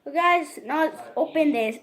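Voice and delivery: ordinary delivery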